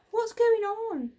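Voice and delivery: loud, high, slow shout; children shouting in panic. A narrator's reading. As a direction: call out in alarm. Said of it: "they shouted".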